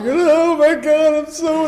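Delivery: high, loud, drawn-out blubbering; mock sobbing. Crying newcomer arrives at sponsor's house, mock sobbing. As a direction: dissolve into hammed-up sobs, words melting away. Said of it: "I'm crying and sobbing".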